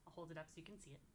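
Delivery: ordinary delivery, nothing marked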